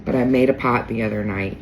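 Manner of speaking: ordinary talk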